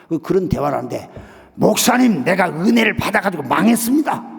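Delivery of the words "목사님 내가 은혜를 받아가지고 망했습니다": loud, higher-pitched aggrieved outburst; raised-voice retold grievance; acted complaint. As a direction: complain loudly down the phone, imitating a ruined man. Acted phone complaint from ruined man.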